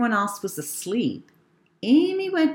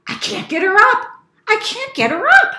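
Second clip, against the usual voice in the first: high, loud, frantic cry; mother's acted panic; 13 semitones higher, 8 dB louder, faster than usual. Mother panics after failing.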